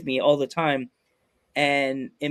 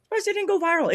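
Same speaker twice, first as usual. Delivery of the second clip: pitched way up in exaggerated disbelief; mock shock; faster than usual. Complaining to cohost about his clip, mock-shocked high voice. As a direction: squeak it like you can't believe it. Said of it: "I'm shocked".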